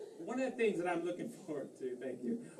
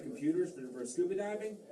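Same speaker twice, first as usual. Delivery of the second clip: ordinary talk, nothing marked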